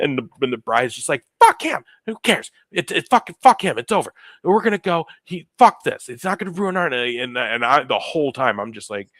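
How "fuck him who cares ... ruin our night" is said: high, loud angry bursts; fed-up bride. Retold or imitated. Bride rallying her groom against his dad, angry defiance. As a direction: spit out short, defiant bursts, pitched up.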